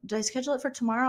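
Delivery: ordinary talk, nothing marked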